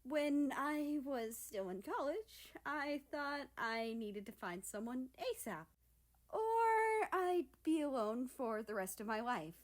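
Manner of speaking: confess, the feeling welling up; a lightly voiced admission; admitting. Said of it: "Japan admitted".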